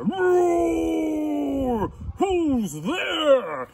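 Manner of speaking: loud, drawn-out lion roar. Acted. Lion answers a knock at his door, roaring loudly.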